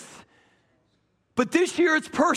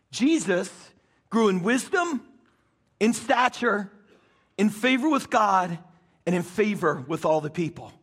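solemn scripture reading; 4 semitones lower than usual. Acted. Reads scripture slowly and solemnly.

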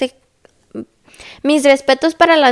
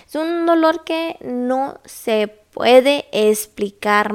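slow, weighty word-by-word delivery; drawn-out emphasis; much slower than usual. Real, not acted. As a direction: stretch out "no se puede explicar".

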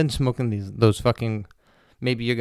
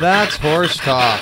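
slow, stretched-out segment title; drawn-out announcer voice; 5 semitones higher, 8 dB louder, much slower than usual. Acted. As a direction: drag it out like a show jingle. Announces his show segment.

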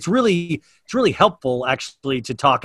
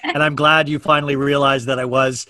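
mock confession; 5 dB louder than usual. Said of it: "What if I was like".

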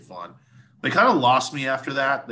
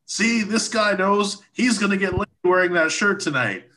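higher, hammed-up frontman voice; mock singer teasing; 8 semitones higher than usual. Singer points at a fan in the crowd, teasing him. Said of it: "he goes".

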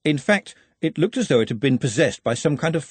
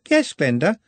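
put-on higher, eager apologetic girl's voice; nervous young Juliet rushing her excuses; anxious young girl; 6 semitones higher, 3 dB louder than usual. A narrator's reading. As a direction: babble it out like a flustered young girl.

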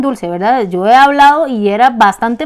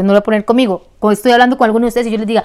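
ordinary delivery

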